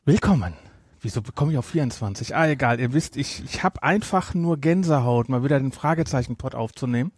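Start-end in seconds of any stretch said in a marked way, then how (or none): none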